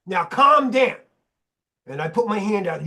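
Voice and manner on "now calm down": slow, loud, high command to a dog; man stopping a biting dog with a loud slow order; loud retold command. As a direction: bark it out slowly to settle a dog. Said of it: "and I said really loud".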